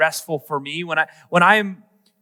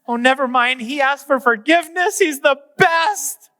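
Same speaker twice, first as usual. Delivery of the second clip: high, gushing, stretched-out swoon; a hammed-up lovestruck squeal; swooning turnaround; 9 semitones higher than usual. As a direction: gush like a smitten movie fan. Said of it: "And you're thinking".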